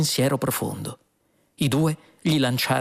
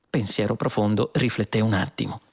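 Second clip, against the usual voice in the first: ordinary delivery, nothing marked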